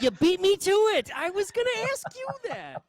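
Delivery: squealed high and loud, playful outrage; mock dismay. Real, not acted. Host squealing after guest steals his question. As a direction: yelp it up high like you've been robbed.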